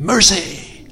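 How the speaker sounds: high, loud soul-singer cry of the word. Retold or imitated. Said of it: "I heard [name]'s voice in my head going".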